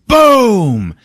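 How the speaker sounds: loud, high, falling "boom"; big drawn-out explosion word; imitated explosion. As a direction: boom it out long like a blast.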